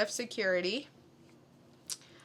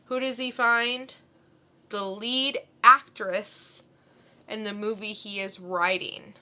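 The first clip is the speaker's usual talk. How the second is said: ordinary delivery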